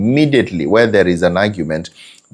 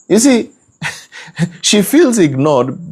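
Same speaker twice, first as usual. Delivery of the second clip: ordinary talk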